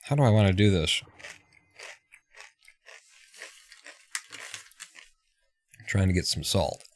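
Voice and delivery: talking while crunching chips. Real, not acted. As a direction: mouth full of chips. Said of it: "I wouldn't be crunching chips on a live stream".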